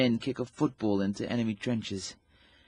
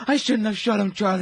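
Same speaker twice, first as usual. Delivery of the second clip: high, loud guilt-stricken cry; anguished delirium; 8 semitones higher, 6 dB louder than usual. Acted. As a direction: cry it out as if reliving it. Delirious soldier crying out guilt.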